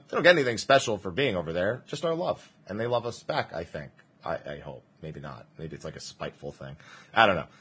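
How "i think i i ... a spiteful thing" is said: quiet aside. Real, not acted. Trailing self-deprecating aside.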